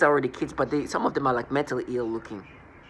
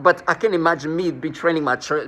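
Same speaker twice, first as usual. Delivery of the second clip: ordinary talk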